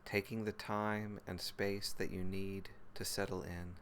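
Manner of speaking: calm, even meditation voice with long pauses; guided meditation. Real, not acted. Teacher leading listeners through body and breath, guided meditation. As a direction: guide them gently into the body, phrase by unhurried phrase.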